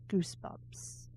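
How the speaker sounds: eerie whispered book title; spooky whisper. Pretend. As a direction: hiss the title like a horror ad. Spooky book trailer tagline.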